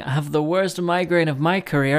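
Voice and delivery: loud, punchy show teaser; podcast intro announcer. Acted. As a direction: announce the episode's highlights at full projection. Reads show teaser over intro.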